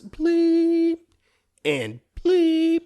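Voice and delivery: high, loud bleep noise; censor bleep imitation.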